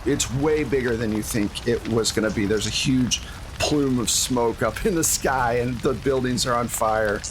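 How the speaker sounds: ordinary talk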